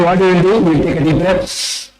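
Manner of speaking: ordinary talk, nothing marked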